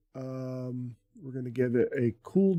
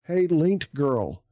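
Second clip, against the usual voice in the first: calling out; 3 semitones higher, 7 dB louder, slower than usual. Real, not acted. Calling out to chat bot.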